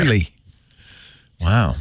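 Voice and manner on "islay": Islay said as "oily", OY-lee; falling "OY-lee" for Islay; brogue-style pronunciation. Shown as a demonstration. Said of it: "Just think oil".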